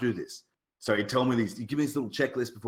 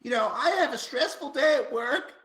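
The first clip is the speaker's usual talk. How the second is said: high-pitched mock American complainer; American accent impression; 14 semitones higher than usual. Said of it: "That is your American accent".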